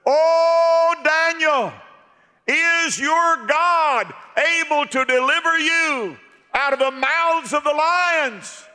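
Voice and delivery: high loud anguished call, slowed; loud high cry of a worried king; king cries out. Retold or imitated. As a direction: call down into the den in dread. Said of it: "he cried out and said".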